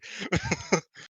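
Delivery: bright burst of laughter; high laugh. Real, not acted. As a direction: laugh at your own joke. Laugh after quote.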